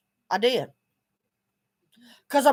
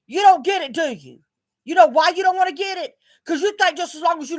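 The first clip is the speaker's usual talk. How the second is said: confronting challenge; 6 semitones higher, 3 dB louder than usual. Real, not acted. Confronting neighbors directly.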